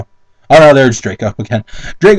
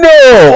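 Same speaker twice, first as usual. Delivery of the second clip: loud, high laughing burst; sudden loud laugh; burst of laughter; 9 semitones higher, 6 dB louder than usual. Real, not acted. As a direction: crack up loudly. Laughing at the fic.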